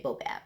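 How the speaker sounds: "boabab" said softly as BO-bab; fun pronunciation. Shown as a demonstration. Teacher inviting kids to repeat a fun word, "boabab" as soft BO-bab. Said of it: "kinda fun to say".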